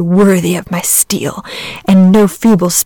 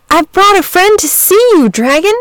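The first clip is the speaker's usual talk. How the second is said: higher and louder, calling out; rather loud; 12 semitones higher, 4 dB louder than usual. A narrator's reading. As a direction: call out to wake the dragon. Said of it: "rather loud".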